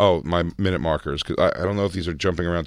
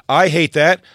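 exaggerated annoyed; 9 semitones higher, 7 dB louder, slower than usual. Ad read emphasis.

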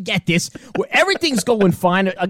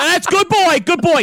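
put-on higher, dismissive good boy; mock Russo; 9 semitones higher than usual. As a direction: wave him off like Dog. Imitating Dog brushing off Eddie.

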